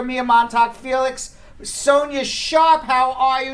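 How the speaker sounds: usual speaking voice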